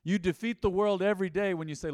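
loud repeated refrain, pushed hard; preaching refrain. Acted. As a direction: hammer the line home.

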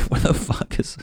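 high, airy comic panic; mock frantic. Alien looking to use the bathroom, mock frantic. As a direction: play a panicked alien for laughs. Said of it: "That's why they're so frantic".

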